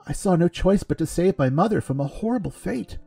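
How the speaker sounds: high, tearful, trembling plea; shaking voice. A narrator's reading. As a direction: voice shaking with tears. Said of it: "Penton's voice shook".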